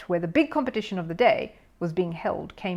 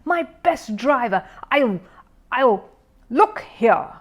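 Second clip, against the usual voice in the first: spluttering; 5 semitones higher, 6 dB louder than usual. A narrator's reading. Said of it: "spluttered Mister Curry".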